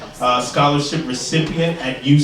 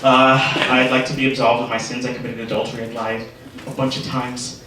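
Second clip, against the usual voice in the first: confessing sinner; 5 semitones lower than usual. Acted. Sinner whispering his sins to the priest, lightly voiced low and hushed. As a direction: murmur it low like a nervous penitent in the booth.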